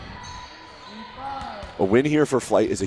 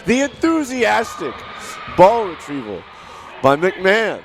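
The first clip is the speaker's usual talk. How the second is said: excited; 7 semitones higher than usual. Acted. Mock-hyped ball retriever call.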